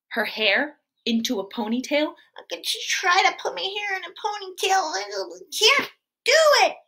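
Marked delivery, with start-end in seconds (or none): 2.5-6.8 put-on whiny little girl. Storyteller voicing the little girl.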